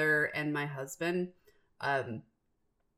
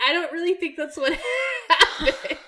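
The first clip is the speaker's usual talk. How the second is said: higher and louder, words broken by laughter; can't finish for laughing; laughing through it; 11 semitones higher, 6 dB louder than usual. Retold or imitated. Wife corrects her husband's take on the movie, laughing.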